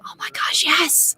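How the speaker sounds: breathless whispered excitement; excited whisper. Retold or imitated. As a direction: whisper it, thrilled. Excited retold reaction.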